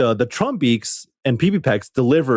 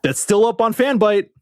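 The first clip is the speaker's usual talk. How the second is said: wry joke; 10 semitones higher than usual. Real, not acted. Pointed joke about deleted site.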